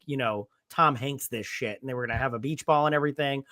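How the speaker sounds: ordinary talk, nothing marked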